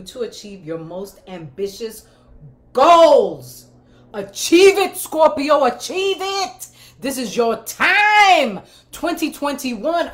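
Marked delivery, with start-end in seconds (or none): 2.7-3.7 excited shout. Real, not acted. Hyping Scorpio goals. 4.1-10.1 cheering. Real, not acted. Cheering on Scorpios.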